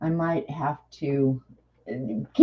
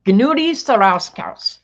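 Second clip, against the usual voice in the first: slow, higher, loud mock German accent; thick German accent; 6 semitones higher, 6 dB louder, slower than usual. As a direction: announce the name in a big German accent. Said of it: "she spoke with a very thick accent".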